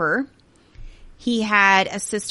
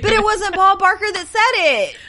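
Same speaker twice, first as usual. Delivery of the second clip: game show host; 12 semitones higher, 5 dB louder than usual. Pretend. Still in host voice.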